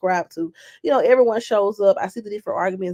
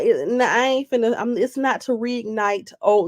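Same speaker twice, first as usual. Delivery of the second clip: ordinary talk, nothing marked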